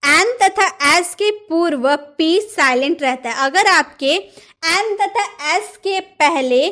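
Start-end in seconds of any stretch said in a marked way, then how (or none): none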